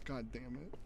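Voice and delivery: ordinary talk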